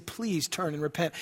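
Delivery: soft, low, tender plea; pleading. Acted. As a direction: beg them gently to come back. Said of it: "it's like the Lord is pleading".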